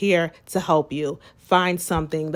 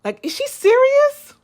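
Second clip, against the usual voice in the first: much higher, loud disbelief; incredulous; 5 dB louder than usual. Retold or imitated. Disbelieving friend's reply, high incredulous squeal.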